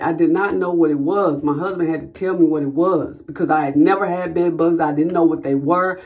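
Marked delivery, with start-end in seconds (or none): none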